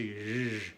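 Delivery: ZH buzzed long with the voice; voiced zh sound. Shown as a demonstration. Teacher demonstrating French g, long buzz. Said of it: "Y la G, que dice".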